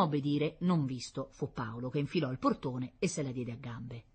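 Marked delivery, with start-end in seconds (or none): none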